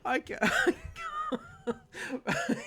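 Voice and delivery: high, squeaky laughing "I can't"; cracking up. Real, not acted. Breaking down laughing at the fan-misters comment. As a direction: dissolve into laughter.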